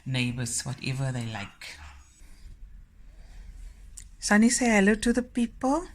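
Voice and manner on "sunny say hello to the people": higher, louder, sing-song call to the dog; cheerful call to the dog. Real, not acted. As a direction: coax the dog to greet the viewers. Talking to the dog.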